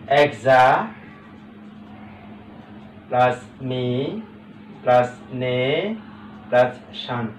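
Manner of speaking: "এক্সা plus মি plus নে plus শান" slowly, with long pauses; syllable by syllable. Shown as a demonstration. Teacher adding up the word's parts for students, slowly spaced. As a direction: give each part of "এক্সা plus মি plus নে plus শান" on its own, drawn out. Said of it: "ভাগ করা অত্যন্ত".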